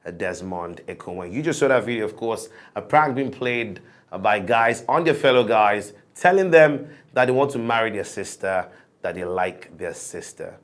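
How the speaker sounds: bright presenter opening, then settling; show host welcome back. Acted. Host to viewers after the break, presenter voice.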